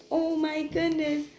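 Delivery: genuinely stunned; astonished. A narrator's reading. Sees monkeys in tree.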